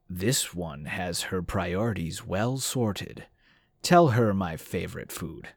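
lightly voiced smug cat, unhurried. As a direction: drawl it like a self-satisfied cat. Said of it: "Raoul said smugly".

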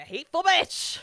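sudden loud burst before laughing; emphatic insult. Real, not acted. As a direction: spit out the verdict at full voice.

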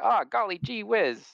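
high, chirpy wholesome fifties voice; 1950s gee-whiz voice. Retold or imitated. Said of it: "fifties where everyone's like".

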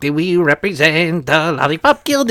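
sing-song little tough guy; munchkin voice. Retold or imitated. Picturing the dog as a Wizard of Oz munchkin. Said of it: "it's like".

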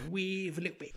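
a light Cockney swagger; mock Cockney geezer. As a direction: play the thumbs-in-braces Cockney. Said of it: "put your thumbs into your braces".

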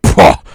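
loud, explosive mocking "pah"; scornful burst. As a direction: imitate a dismissive guffaw with no respect. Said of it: "he was like".

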